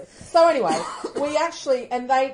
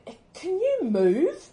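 imitating a rude woman, higher, louder and slow; rude demand; 9 semitones higher, 5 dB louder, slower than usual. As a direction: snap it out like a pushy stranger. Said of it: "and then just went".

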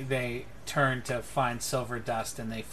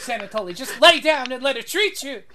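loud, high, exasperated scolding; exasperated outburst; 11 semitones higher, 12 dB louder than usual. Acted. Caruso telling the old man to be treated, loud exasperation. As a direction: burst out at a stubborn patient. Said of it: "And Caruso goes".

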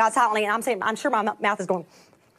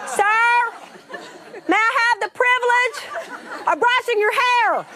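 speaking up; 9 semitones higher, slower than usual. Retold or imitated. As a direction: call it out for the whole room. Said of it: "you're gonna have to speak up".

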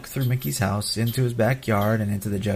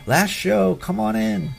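calling out; 9 semitones higher, slower than usual. Retold or imitated. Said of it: "a cast member was shouting".

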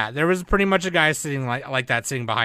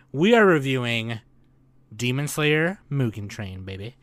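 ordinary talk

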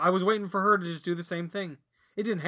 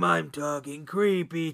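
mock creepy voice; 3 semitones lower, slower than usual. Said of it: "talking, like, creepy like this".

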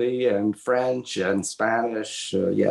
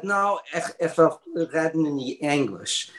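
higher, lightly voiced old mother with a Yiddish accent; a hint of his mother, higher, insisting on English; mother's voice; 5 semitones higher than usual. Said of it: "She, she said".

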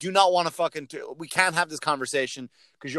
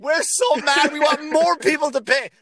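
mock outraged fans; 9 semitones higher, 5 dB louder than usual. Mocking vengeful movie fans.

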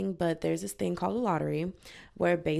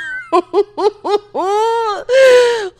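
big shrieking laugh, high and loud; 16 dB louder than usual. Real, not acted. Vlogger cracking up at her own slip, loud shrieking laugh. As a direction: burst out laughing hard.

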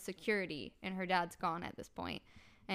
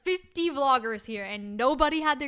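loud, high, emphatic complaint; exasperated disbelief; 5 semitones higher, 7 dB louder than usual. Retold or imitated. Vlogger amazed nobody filmed the fight, loudly exasperated. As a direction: re-enact indignant amazement. Retold complaint about missed footage.

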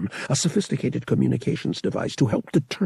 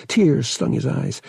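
plain reading voice

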